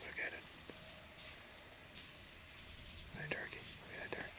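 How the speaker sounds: soft whisper at a turkey. Real, not acted. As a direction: keep it barely audible.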